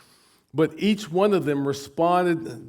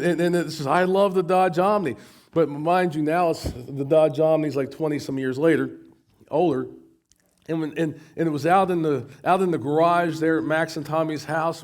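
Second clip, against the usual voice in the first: usual speaking voice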